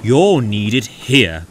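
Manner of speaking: loud, slow grumble; stern, drawn-out grumble; grumbling. A narrator's reading. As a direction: grumble it out heavily and slowly. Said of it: "grumbled Henry".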